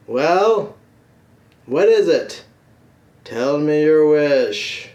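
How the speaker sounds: loud, booming genie voice; impatient genie. Acted. Genie to the last man left, loud impatient demand. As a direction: demand it grandly, drawing out the last words.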